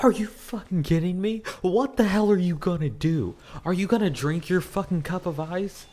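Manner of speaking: high-pitched, incredulous ranting; exasperated outburst. Retold or imitated. Acted outburst at difficult customer.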